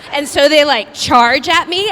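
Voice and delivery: ordinary talk, nothing marked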